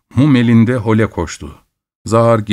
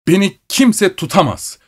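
higher-pitched indignant shout; 9 semitones higher than usual. A narrator's reading. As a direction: shout him down, rising in pitch. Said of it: "diye bağırıyordu". (yüksek perdeden öfkeli bağırış)

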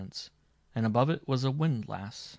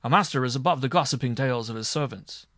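louder, indignant pronouncement; proud, heated protest with raised force; storming; 3 semitones higher, 6 dB louder than usual. A narrator's reading. Marshal scorns servants' gossip before the judge, storming. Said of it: "stormed the marshal".

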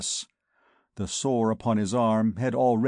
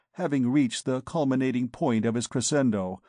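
ordinary delivery, nothing marked

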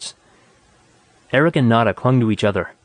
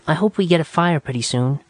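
put-on young woman voice; 5 semitones higher than usual. A narrator's reading. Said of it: "Nada speaking".

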